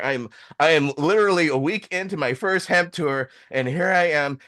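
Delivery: normal talking voice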